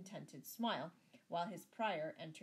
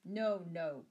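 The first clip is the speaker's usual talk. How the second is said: loud, abrupt refusal; breaking in; 10 dB louder than usual. A narrator's reading. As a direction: cut in loudly to refuse. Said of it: "broke in the justice".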